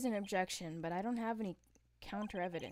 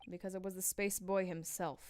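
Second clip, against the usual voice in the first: usual speaking voice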